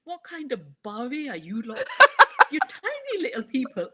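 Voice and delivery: amused thought. Retold or imitated. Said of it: "in my head, I'm thinking".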